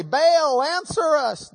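high desperate call to a god; mock plea to an idol; calling out to Baal. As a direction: cry out to Baal, pleading.

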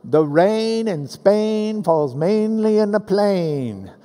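slow, drawn-out rhyming vowels; sing-songy recitation, long held vowels. Shown as a demonstration. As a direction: stretch each rhyme in a slow lilt. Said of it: "there's little sing-songy ways".

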